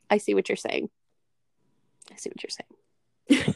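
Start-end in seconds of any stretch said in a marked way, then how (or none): 2.1-3.5 slow, whispered concession; hushed, drawn-out whispering. Real, not acted.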